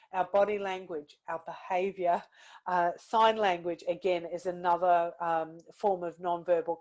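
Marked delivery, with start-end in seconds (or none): none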